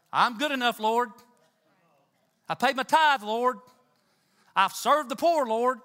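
a loud, smug churchgoer listing his good deeds; proud self-righteous plea. Acted. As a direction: brag to God about your good works, loudly. Cain's proud plea voiced.

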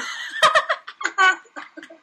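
loud, high burst of laughter; big laugh. Real, not acted. Laughing at the picture.

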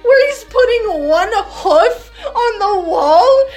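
high, loud laugh-crying; half laughing, half wailing in horror; cracking up in disbelief at the picture. Real, not acted. Horrified laughter at the game art.

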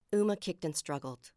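ordinary delivery

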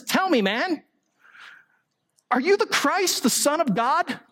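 high, pressing demand from the interrogator; accusing high priest. Retold or imitated. High priest demands an answer from Jesus, pitched high and pressing.

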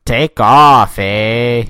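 loud hammed-up Canadian "take off, eh"; mock Canadian shout. Teasing his cohost about Canada, loud mock Canadian accent. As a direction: bark it like a mock Canadian hoser.

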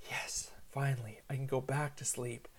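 soft, low near-whisper; hushed relief. Retold or imitated. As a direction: celebrate under your breath so the child stays asleep. Relieved dad, child finally calmed down.

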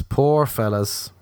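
Poor fellas drawn out slowly; slow pity. Shown as a demonstration. Mocking men without personalities, slow mock pity.

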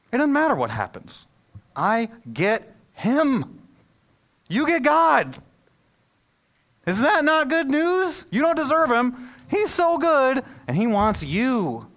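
high, loud, drawn-out preaching; preaching at full force. Acted. Pastor urging his congregation that God is good news, preaching at full force. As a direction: lift the room with it, ringing and slow on key words.

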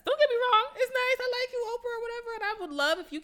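high, loud sugary mock praise; mock sweet to Oprah. Friend addresses Oprah mockingly, high loud sugary praise.

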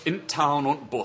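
northern English, clipped-down "the"; northern English accent. Shown as a demonstration. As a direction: demonstrate a broad northern English dialect. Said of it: "Up north, they say".

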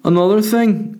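put-on high-pitched drunk fan butting in; chirping up. Drunk fella piping up again behind comedian, chirpy. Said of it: "he just chirps up again".

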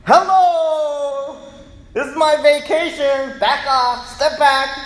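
high, loud "back off" yell; mock indignant holler; annoyed shout. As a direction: shoo people away from your vacation. Preacher voicing annoyed vacationer.

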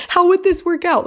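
high-pitched incredulous question. Retold or imitated. Wife doubting the deal could work, incredulous. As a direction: shoot up in pitch with disbelief. Disbelief that offer is real.

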